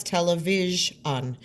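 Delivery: "television" drawn out by syllable; "television" at a slow, chunked pace. Shown as a demonstration. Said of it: "keep those chunks".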